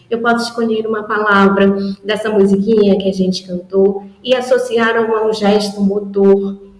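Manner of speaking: ordinary delivery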